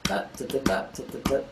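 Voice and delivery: soft, short "da" syllables in rhythm; vocalized drum rhythm. Shown as a demonstration. Teacher sounding out sixteenths while notating, vocalized drum rhythm. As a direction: sound out the drum part under your breath.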